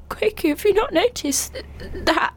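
high-pitched urgent alarm, acted out. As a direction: retell a panicked, breathless-to-be-heard plea. Retold urgent alarm at flatmate.

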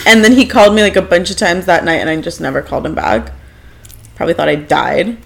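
ordinary talk, nothing marked

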